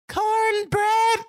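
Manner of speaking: high-pitched, loud mimicked kid voice; mimicking a tiny Southern niece, very high; Southern little girl. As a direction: squeal it like an excited Southern kindergartner. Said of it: "a little Southern".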